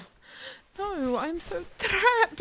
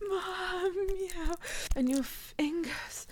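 ordinary delivery

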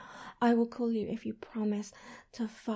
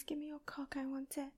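"Give me your cock" breathed much softer, barely voiced; whispered plea; 2 semitones higher, 12 dB quieter than usual. Lover begging a partner, hushed whisper.

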